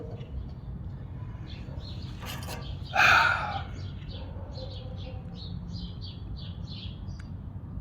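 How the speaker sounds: a long, drawn-out sigh of despair; long heavy sigh. Real, not acted. Mourning Europe's fate, he heaves a long weary sigh. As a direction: let the breath out slow and heavy. Grief over Europe in rant.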